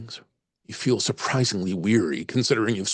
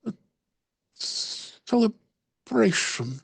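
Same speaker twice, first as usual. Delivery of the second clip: very slow, fading Irish lilt; trailing off; 10 semitones higher, much slower than usual. Acted. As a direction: let the word drift away dreamily. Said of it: "She tails off for a moment".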